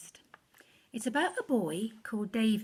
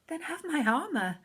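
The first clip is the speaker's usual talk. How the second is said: a lightly voiced king, a touch higher; 6 semitones higher, 3 dB louder, faster than usual. The king offering David armour, lightly regal. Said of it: "said the king".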